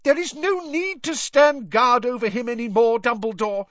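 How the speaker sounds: high, loud, furious shriek; McGonagall's shriek. A narrator's reading. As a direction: shriek it, out of control with anger. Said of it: "she shrieked".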